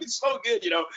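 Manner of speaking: high mock-scolding teasing voice; playful roast. Coach roasting a player at practice, high mock teasing. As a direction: tease the player in front of the team. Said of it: "I'm just roasting this kid".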